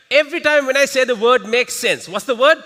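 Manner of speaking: high, loud energetic call; loud announcement. Real, not acted. Trainer giving the room a game rule, loud. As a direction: project to the whole room.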